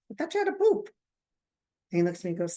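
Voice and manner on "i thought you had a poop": high-pitched acted surprise; puzzled teasing. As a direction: imitate puzzled siblings in a raised voice. Siblings question returning brother, retold.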